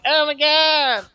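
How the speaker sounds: high, loud, drawn-out fake scream; overacted cry of fear; mock terror. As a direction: shriek like a horror victim, hammed up. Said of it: "And then we're like".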